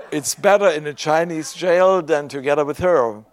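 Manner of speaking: laughing. Real, not acted. Preacher joking to congregation about his wife, laughing. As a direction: laugh as the joke lands.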